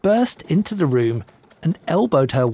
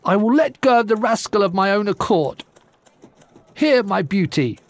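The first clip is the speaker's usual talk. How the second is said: scornful giantess; 6 semitones higher than usual. Acted. Giantess releases the groom.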